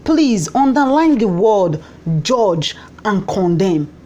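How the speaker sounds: JUDGE and CONDEMN said slowly and set apart; pause, then slowly weigh out "judge and condemn"; emphatic underlining. Real, not acted. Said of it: "I want you to underline this word".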